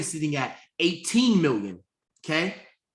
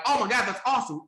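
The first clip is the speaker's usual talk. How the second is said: higher-pitched imitation of an admirer; mock gushing praise; 7 semitones higher than usual.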